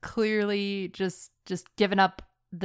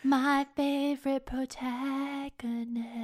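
mock reference voice; 4 semitones higher, 7 dB quieter than usual. Co-host repeating a running bit, teasing reference voice. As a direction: stretch it out teasingly and let it fade. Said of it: "You could just gotta stop doing that".

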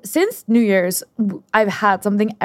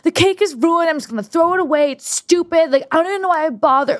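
higher, whiny meltdown voice, faster at the end; catastrophizing self; 8 semitones higher than usual. Retold or imitated. Imitating her past self ruining the cake, high and despairing. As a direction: wail it like everything's ruined. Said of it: "I think I catastrophize".

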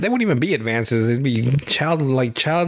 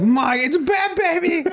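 high, stretched-out cry of alarm; mock alarm; slower than usual. Bystander warns a friend of the intruder, mock-alarmed high cry. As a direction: cry out in hammed-up horror. Acted alarm at baby breaking in.

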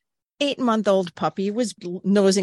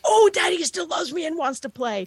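happy puppy voice; 9 semitones higher than usual. Retold or imitated. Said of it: "He went".

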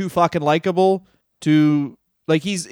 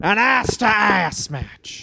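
slow hammy pitch; 6 semitones higher, much slower than usual. Pretend. Slow high pitch line.

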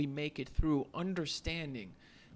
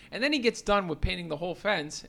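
ordinary talk, nothing marked

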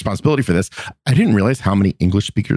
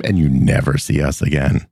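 ordinary talk

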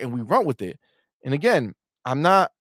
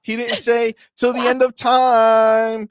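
loud, high mock voice of a fan twisting words; mocking Sony fan; 7 semitones higher, 5 dB louder than usual. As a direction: ham up a smug fan nitpicking. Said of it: "be like".

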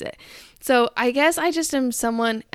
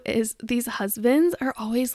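ordinary talk, nothing marked

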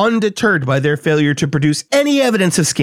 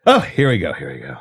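normal talking voice

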